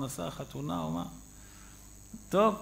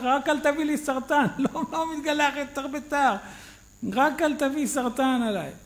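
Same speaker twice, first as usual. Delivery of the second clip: laughing plea; 8 semitones higher, 6 dB louder than usual. Retold or imitated. Frightened driver pleading, laughing.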